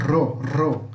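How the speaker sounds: Ro with a long tongue-tip trill; isolated trilled syllable Ro; vibrating rr. Shown as a demonstration. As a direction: roll the R hard in Ro, ro. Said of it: "Que vibra".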